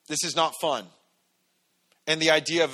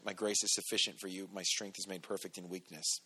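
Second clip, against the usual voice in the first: hushed God voice; 6 semitones lower, 11 dB quieter than usual. Acted. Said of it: "Here's your answer".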